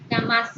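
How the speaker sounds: ja-MÁS, demonstrated for a class; stress on last syllable. Shown as a demonstration. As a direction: lean on the last syllable of "Jamás". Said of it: "con mayor intensidad".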